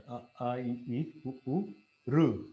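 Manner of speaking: a, a, i, i, u, u, ru each said one by one; walk through the vowels one at a time; reciting vowel series. Shown as a demonstration. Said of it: "what is that".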